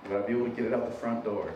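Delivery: normal talking voice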